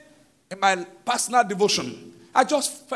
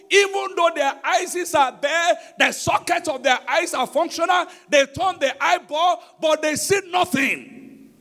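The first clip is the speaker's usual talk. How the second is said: full-force preaching; 6 semitones higher than usual. Acted. Pastor drives home seeing as God sees, full-force preaching. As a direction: hammer each phrase home to the congregation.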